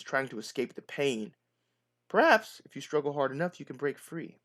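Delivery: ordinary talk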